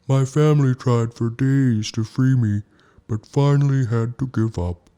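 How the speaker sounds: a trapped whale telling of his lost family; sad whale. A narrator's reading. Whale tells Snow Bear his family gave up, sorrowful.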